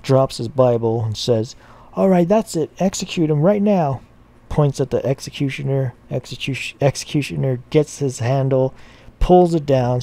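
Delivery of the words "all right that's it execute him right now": high, loud panicked shout; freaked-out yell. Acted. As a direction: yell it like the priest just lost it. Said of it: "The priest freaks out".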